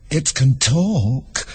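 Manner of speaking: very slow, solemn pronouncement. A narrator's reading. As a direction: announce it gravely, stretching each word. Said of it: "said Haya solemnly".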